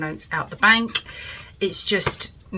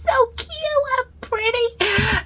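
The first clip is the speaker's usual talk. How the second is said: much higher, slow cooing over something cute; cutesy gushing; slower than usual. Real, not acted. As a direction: coo over it like a tiny treasure.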